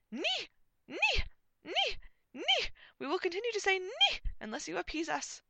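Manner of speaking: very high, loud falsetto cries; piercing comic squeals; shrieking knight. A narrator's reading. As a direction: shriek the word, then threaten in high voice. Knights threaten with their word.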